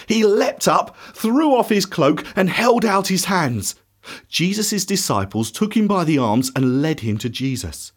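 ordinary delivery

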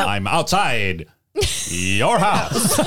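high, slow, laughing caller voice; creepy caller voice. Retold or imitated. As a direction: menace them like a laughing phone stalker. Scream phone caller imitation.

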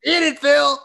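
put-on high loud Yiddish-accented jab. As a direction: snap it like a Yiddish grandma. Yiddish-flavored jabs at Phil.

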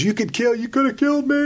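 retold screaming. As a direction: squeal it like the flustered guy yelling back. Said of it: "as he's screaming more".

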